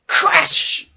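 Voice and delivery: sudden loud burst on the word; explosive crash. A narrator's reading. Head hits the tree root, explosive loud crash. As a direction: punch it out like an impact. Narrator voices the impact.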